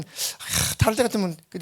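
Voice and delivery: normal talking voice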